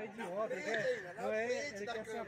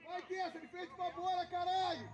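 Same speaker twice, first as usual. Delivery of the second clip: shouting; 8 semitones higher, 8 dB louder than usual. Real, not acted. Yelling at players during match.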